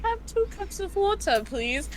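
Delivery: mock British polite request; British accent. Teller imitating a customer at the window, British accent. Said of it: "British accent".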